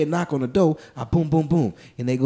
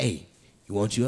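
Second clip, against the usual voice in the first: hushed hustler; 9 semitones lower, 8 dB quieter than usual. Retold or imitated. Pimp voice in the alley.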